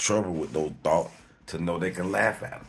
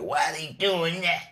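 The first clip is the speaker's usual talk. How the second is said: high, suspicious little voice in his head; nagging inner voice; 12 semitones higher, 4 dB louder than usual. Retold or imitated. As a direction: play the fussy voice of the mind, much higher. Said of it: "your little voice in your head make you be like".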